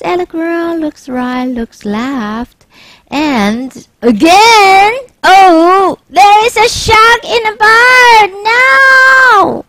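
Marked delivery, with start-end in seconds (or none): 4.0-9.6 high, loud panicked cry; boy alarmed by the shark again; panicked shout. Acted. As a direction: shout it out in terror.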